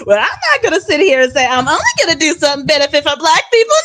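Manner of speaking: high, fast, whiny mimicry; mock-imitation rising into a squeal; mocking quote. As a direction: parrot the politician in a hammed-up high voice. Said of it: "when you had said four years ago".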